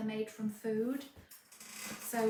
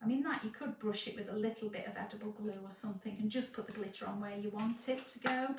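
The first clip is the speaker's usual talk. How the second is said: ordinary talk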